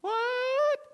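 very high falsetto shout; shrieked reply from another room; wife calling back. Acted. Wife answering her husband from afar, high falsetto.

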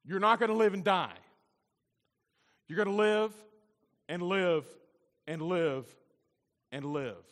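emphatic repetition that steps down to normal volume; preaching, fading out. Acted. Pastor promises endless life to the congregation, preaching force gradually fading. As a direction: start loud and high, ease down with each repeat.

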